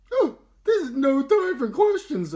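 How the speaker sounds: high, loud, urgent cow voice; sputtering. Acted. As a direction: blurt it out in a rush of worry. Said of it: "Olivia sputtered".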